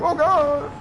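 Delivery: high, loud hammed-up cry of pain; mock enemy scream. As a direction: yelp it like a beaten game enemy. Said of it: "to hear them scream".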